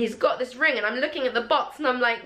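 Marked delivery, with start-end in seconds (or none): none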